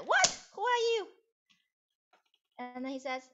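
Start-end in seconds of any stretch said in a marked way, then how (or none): 0.0-1.1 high, loud startled cry of "What? Who are you?"; startled shout. Acted. Musician startled by a stranger with a knife, shouting in surprise. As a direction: jump back in alarm, voice flying up.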